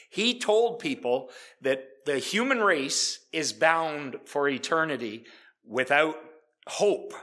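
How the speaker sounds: loud, ringing sermon delivery; full-voiced pulpit preaching; forceful preaching. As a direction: preach it out to the congregation.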